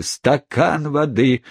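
mock the request in a rage; furious brother throwing Pickwick's words back; enraged outcry. A narrator's reading. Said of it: "вскричал взбешённый Уорд".

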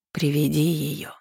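slow, rustling otherworldly voice; sinister voice. A narrator's reading. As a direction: let it drift like wind in the dark. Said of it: "прошелестело со всех сторон в темноте".

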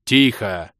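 sharp barked command; barked order. A narrator's reading. Hero silencing screaming companions mid-flight, barked. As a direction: snap the word out as an order. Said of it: "рявкнул я".

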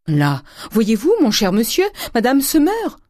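loud, high, climbing alarm; alarmed cry. A narrator's reading. Servant telling her master madame is dying, alarmed cry. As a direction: cry out with rising pitch. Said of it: "cria Nanon".

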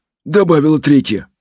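ordinary delivery

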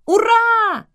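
high, loud cheering yell; excited shout. A narrator's reading. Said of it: "закричала я". (радостно закричать)

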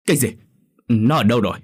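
urgent, startled question. Acted. Neighbour asking the child where the girl is, urgent questioning. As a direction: demand an answer at once. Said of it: "Bà Tình hỏi gấp".

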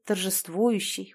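ordinary delivery

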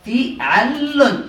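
«فعل» drawn out as fi-'al-lun; doubled lam. Shown as a demonstration. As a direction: say «فعل» very slowly, holding the doubled l. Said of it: "اللام مشددة".

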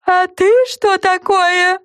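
high, slow squeal back. A narrator's reading. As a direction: squeal back in mock fright. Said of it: "взвизгнул в ответ леденец".

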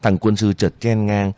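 plain reading voice

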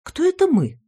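very high astonished gasp. A narrator's reading. Hostess realizing the boy is here, astonished. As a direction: gasp out the question in shock. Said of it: "ахнула я".

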